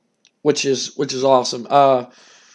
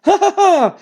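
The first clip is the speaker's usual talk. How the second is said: much higher voice with cackling laugh; Jim Cornette gloating; 14 semitones higher than usual. Retold or imitated. Manager mocking the men crawling out, high cackling taunt. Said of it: "He's like".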